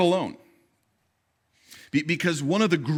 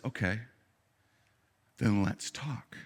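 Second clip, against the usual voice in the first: much softer, lower calm reply; gentle answer; 4 semitones lower, 9 dB quieter than usual. Acted. God answering lonely Elijah, soft and low. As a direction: answer softly, almost intimately.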